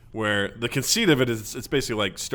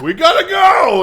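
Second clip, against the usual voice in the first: loud, high urgent shout; Noah yelling; 13 dB louder than usual. Retold or imitated. Noah hurrying the animals, high loud shout. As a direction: yell it to hurry everyone aboard. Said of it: "Noah's like".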